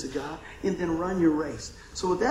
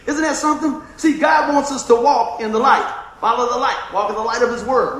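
fiery preaching; 5 semitones higher, 8 dB louder than usual. Acted. Preacher calls congregation to walk in the light, shouting. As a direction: shout the gospel, much louder and higher.

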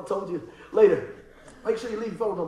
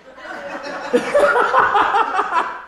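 high, loud cackling laugh; 12 semitones higher, 7 dB louder than usual. Real, not acted. Preacher cracking up at his own hairstyle joke, loud laugh.

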